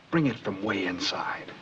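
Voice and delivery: slow, near-whispered coaching; hushed intensity. Real, not acted. Director urging actress to stand still and feel it, hushed. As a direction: drop to a soft, slow hush.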